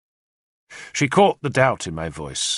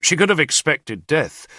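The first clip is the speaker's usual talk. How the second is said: ordinary delivery, nothing marked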